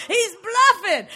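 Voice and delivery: chuckling retell; laughing echo. Retold or imitated. Preacher laughing, repeating husband's words to the congregation. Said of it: "Is that right?".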